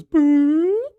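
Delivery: high, loud cartoon whoop; long high tone as it flies off; departing brain noise. Simpsons brain leaving its owner, cartoon noise. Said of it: "And his brain goes".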